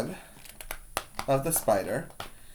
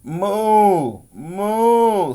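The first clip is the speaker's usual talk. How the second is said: long, higher cow moos; mooing cow; 7 semitones higher, 4 dB louder than usual. A narrator's reading. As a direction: moo like a cow for a picture book. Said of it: "said the cow".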